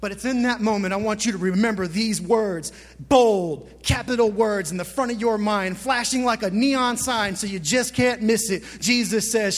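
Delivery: ordinary delivery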